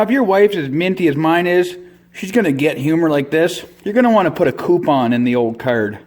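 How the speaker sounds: ordinary delivery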